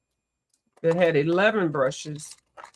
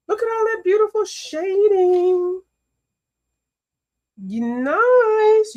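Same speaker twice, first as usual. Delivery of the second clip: high, loud gushing over the shading; squealing praise, high and loud; excited praise; 12 semitones higher, 5 dB louder than usual. Real, not acted. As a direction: gush like a proud fan.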